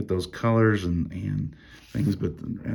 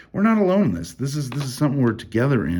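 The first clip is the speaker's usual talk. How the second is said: excited; 6 semitones higher, 4 dB louder, faster than usual. Real, not acted. Preacher stresses shared faith.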